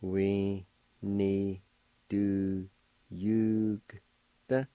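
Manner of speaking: We need to yugta given word by word with gaps; teacher sounding out each word; split syllables. Shown as a demonstration. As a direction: break We need to yugta apart slowly. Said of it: "pronounce the G sound with the Y U like this".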